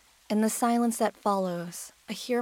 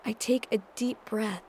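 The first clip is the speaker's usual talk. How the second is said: ordinary delivery, nothing marked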